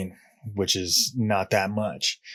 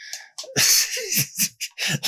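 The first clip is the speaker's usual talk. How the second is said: wheezing high laugh. Real, not acted. Laughing at his own story.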